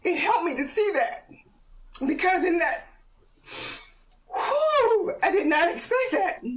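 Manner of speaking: real emotion rising in the voice, pitch climbing high; fighting back tears mid-sentence; choked up. Real, not acted. Speaker admits being moved while telling the podcast host. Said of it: "I don't know why I'm getting emotional about this".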